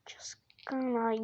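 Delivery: soft whisper. Real, not acted. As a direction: breathe it.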